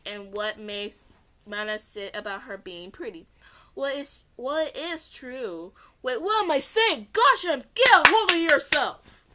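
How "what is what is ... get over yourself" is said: put-on flustered girl voice. Flustered character voice.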